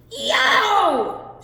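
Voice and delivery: high loud yelp of pain; giant's pained yelp. Acted. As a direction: yelp as teeth crack on iron. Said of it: "shouted Cucullin".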